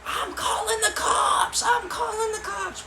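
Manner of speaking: very high, crying shriek; screaming homeowner. Retold or imitated. Homeowner threatening the kids, high crying shriek. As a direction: shriek it in a panicked falsetto. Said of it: "And then they're screaming".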